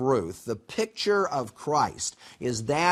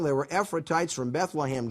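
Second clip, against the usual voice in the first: ordinary delivery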